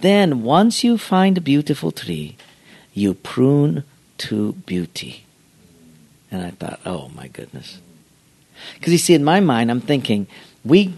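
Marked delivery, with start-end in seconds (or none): 0.0-5.2 imitated Japanese accent, slowing at the end; Mister Miyagi. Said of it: "And he said". 6.8-7.8 very low, soft murmur; hushed awe. Retold or imitated. Retells his awed private thought.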